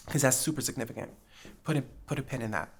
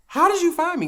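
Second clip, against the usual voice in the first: shocked high question; 9 dB louder than usual. Retold or imitated. Retold shock at being recognized.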